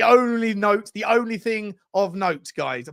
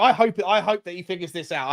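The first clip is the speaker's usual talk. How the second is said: ordinary talk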